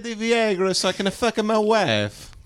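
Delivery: put-on Italian accent. Mock Italian mobster line.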